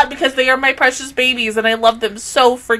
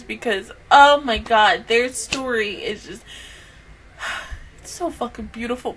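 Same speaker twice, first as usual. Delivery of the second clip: ordinary delivery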